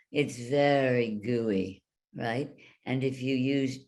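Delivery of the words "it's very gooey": ordinary talk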